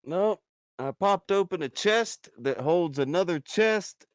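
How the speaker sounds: excited. Real, not acted. Hyping a rare chest reveal.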